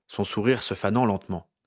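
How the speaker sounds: ordinary delivery, nothing marked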